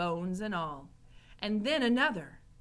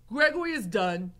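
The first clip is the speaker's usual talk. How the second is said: louder, heavy character voice; Gregory; 9 dB louder than usual. A narrator's reading. Gregory handing back the tray, loud old-man voice. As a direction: announce it emphatically. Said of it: "he said".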